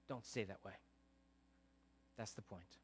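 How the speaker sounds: dropped low and soft; hushed sermon punchline; quiet. Acted. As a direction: let the point sink in softly.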